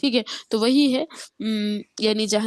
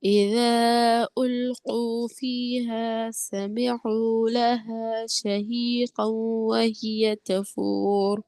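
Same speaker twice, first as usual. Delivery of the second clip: very slow, drawn-out tajweed chant; Quran recitation; much slower than usual. Shown as a demonstration. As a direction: stretch each word in a melodic recitation. Melodic recitation of the verse.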